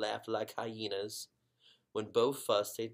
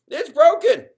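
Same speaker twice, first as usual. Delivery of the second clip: much higher, loud cry of alarm; boy crying out, much higher and louder; exclaiming; 22 dB louder, slower than usual. Acted. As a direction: shout it out in shock. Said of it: "he exclaimed".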